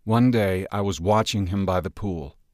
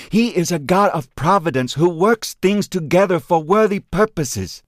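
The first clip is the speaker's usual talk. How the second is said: put-on higher, impassioned voice of the old friend; earnest friend pleading; 8 semitones higher, 4 dB louder than usual. A narrator's reading. Chuan answering Ben's grief with fervent conviction. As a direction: press the truth on him with fervour. Chuan states his belief.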